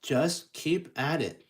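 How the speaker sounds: ordinary talk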